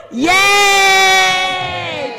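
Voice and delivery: normal talking voice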